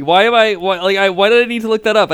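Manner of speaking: high, loud self-scolding; exasperated outburst. Real, not acted. Host annoyed she had to look Connery up, loud. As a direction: yell at yourself for forgetting.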